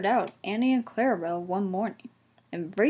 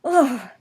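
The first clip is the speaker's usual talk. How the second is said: loud higher shudder; shivering ugh; 5 semitones higher, 7 dB louder than usual. A narrator's reading. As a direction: shudder at the cold water. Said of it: "he shivered".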